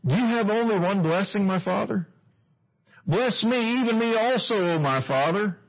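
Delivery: high, pleading cry to his father; pleading Esau. Acted. Esau begging his father, pleading high.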